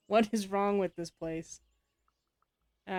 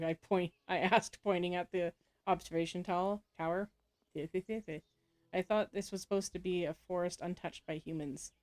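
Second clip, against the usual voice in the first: ordinary talk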